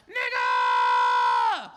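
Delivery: loud slur. Retold or imitated. Heckler yells back when dared, deep and loud. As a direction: bellow it deep from the crowd. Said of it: "He was like".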